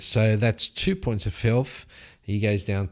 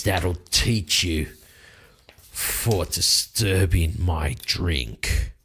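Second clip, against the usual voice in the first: lower, slow, satisfied threat; grumbling knight; 5 semitones lower, slower than usual. Acted. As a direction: drag it out, smug after the kill. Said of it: "And he goes".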